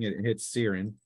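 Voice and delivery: ordinary talk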